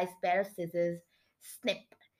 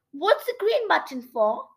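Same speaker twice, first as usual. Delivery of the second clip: high-pitched, inquisitive question; narrated curiosity, voice raised; curious; 7 semitones higher, 3 dB louder than usual. A narrator's reading. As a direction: ask with lifted, eager curiosity.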